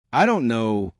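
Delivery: ordinary delivery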